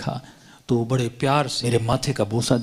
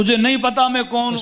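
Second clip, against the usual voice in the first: raised, higher challenge, half-acted; menacing challenge; 11 semitones higher, 3 dB louder, faster than usual. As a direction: throw down who-do-you-think-I-am. Woman threatens the driver.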